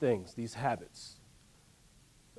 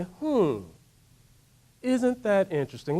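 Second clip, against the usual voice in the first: curious musing; 3 semitones higher than usual. Shown as a demonstration. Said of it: "say it with me now".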